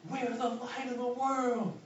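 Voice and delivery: high, slow proclaiming shout; yelling. Retold or imitated. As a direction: call it out from the dark alley. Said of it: "And he yells".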